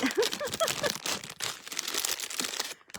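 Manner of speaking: airy high-pitched giggle; high breathy laugh. Real, not acted. Laughing at her own panic about hyperventilating, high breathy giggle.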